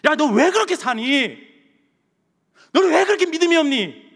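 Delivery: loud, high-pitched acted rebuke; 예수님의 책망 흉내. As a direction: scold a doubter as Jesus might. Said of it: "예수님이 책망하셨어요?". (크고 높게 꾸짖는 연기)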